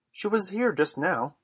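a little impatient. A narrator's reading. Peter answers Wendy about the missing fairy, a little impatient. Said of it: "he said a little impatiently".

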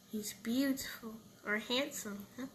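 hushed whisper of admiration; whispered, tender praise. Acted. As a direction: breathe it out in a whisper.

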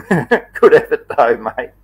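real chuckle through the words; laughing. Real, not acted. Host congratulating a viewer on his effort, laughing.

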